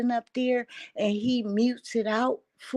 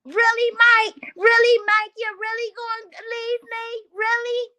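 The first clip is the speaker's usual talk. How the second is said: put-on much higher, hurt and pleading girl; high disbelieving wail; 13 semitones higher, 6 dB louder than usual. Ashley's reply, voiced high.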